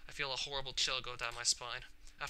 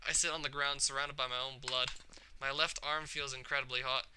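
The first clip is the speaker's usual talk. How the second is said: plain reading voice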